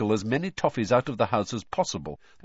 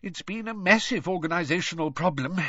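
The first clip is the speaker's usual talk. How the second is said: weary sigh in a higher-pitched voice; 10 semitones higher than usual. A narrator's reading. Father answering about the crowds, sighing. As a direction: let it out as a tired complaint. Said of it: "sighed Mister Weasley".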